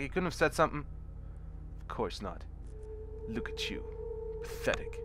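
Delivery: normal talking voice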